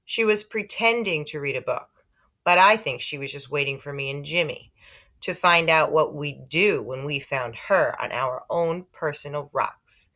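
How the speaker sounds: usual speaking voice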